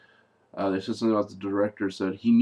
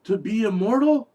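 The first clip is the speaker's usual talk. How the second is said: high-pitched disbelief, rising into a drawn-out why; imitated astonishment, voice pitched way up; incredulous questioner; 10 semitones higher, 3 dB louder, slower than usual. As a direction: act out a baffled, high-pitched demand. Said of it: "he said".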